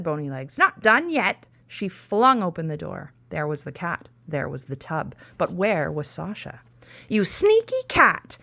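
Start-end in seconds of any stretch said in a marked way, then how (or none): 0.6-1.4 high, loud furious cry; angry witch. Acted. Witch realising she was tricked, high furious cry. 7.1-8.4 high, loud, slow shriek; yelling witch. A narrator's reading. Witch yelling at the tricky cat, high screech. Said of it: "yelled Bony Legs".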